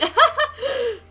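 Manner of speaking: high, loud burst of laughter. Real, not acted. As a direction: laugh out at your own embarrassing picture. Laughs at horrifying first slide.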